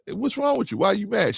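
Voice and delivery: husband mocking his wife's innocent call. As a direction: mimic her innocent asking. Wife calling, mimicked by husband.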